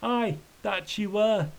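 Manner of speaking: high, slow, mildly plaintive wail; understated acted wail. A narrator's reading. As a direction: draw it out in a high lament. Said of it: "Thomas wailed".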